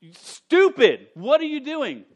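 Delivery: high, loud, drawn-out "stupid"; exasperated rebuke. Retold or imitated. Stephen's rebuke voiced as a loud, high exasperated outburst. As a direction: berate them in exasperation. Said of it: "that's sort of what he's saying".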